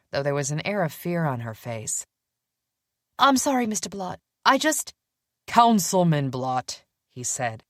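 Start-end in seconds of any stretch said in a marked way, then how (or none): 3.2-4.9 put-on nervous young woman. A narrator's reading. Willa afraid of boss. 5.5-6.8 stern man voice. A narrator's reading. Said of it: "frowning at his assistant".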